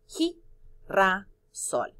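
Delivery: "Gi-ra-sol" very slow, syllable by syllable. Shown as a demonstration. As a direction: stretch out "Gi-ra-sol" for the class. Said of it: "¿Qué son las tres sílabas?".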